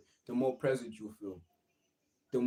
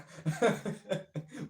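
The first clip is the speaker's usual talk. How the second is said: giggle; 8 semitones higher than usual. Real, not acted. Laughing through apology.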